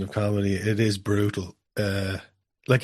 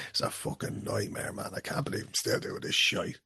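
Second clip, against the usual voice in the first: put-on Dublin accent; 11 dB quieter than usual. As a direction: grumble it in a thick Dublin accent. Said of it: "in the thick Dublin acts".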